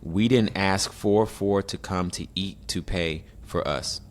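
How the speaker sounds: slow, halting word-by-word reading; reading aloud haltingly. Shown as a demonstration. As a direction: read it stiffly, word by word. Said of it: "I'ma read it exactly how they wrote it".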